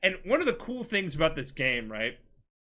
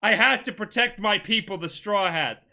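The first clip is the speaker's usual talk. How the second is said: louder, higher, drawn-out dramatic delivery; acted defiant retort; 6 semitones higher, 5 dB louder, slower than usual. Betrayer answering his friend mid-duel, loud defiant retort. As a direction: argue back as the retold betrayer.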